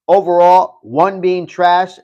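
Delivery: normal talking voice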